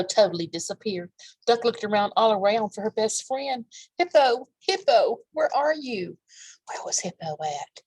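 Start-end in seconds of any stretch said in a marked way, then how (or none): 4.0-6.2 calling out for a lost friend. Acted. 6.7-7.8 whispered question; soft whispered question to the listeners. Real, not acted. Aside whispered question to kids.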